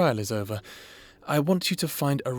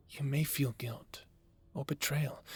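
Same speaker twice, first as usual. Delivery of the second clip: soft whisper; 3 semitones higher, 7 dB quieter than usual. Acted. Therapist gently names hidden feelings.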